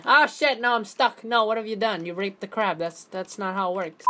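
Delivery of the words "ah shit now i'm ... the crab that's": ordinary talk, nothing marked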